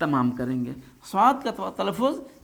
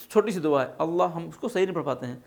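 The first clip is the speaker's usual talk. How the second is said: ordinary delivery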